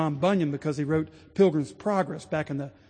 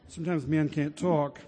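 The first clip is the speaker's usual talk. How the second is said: usual speaking voice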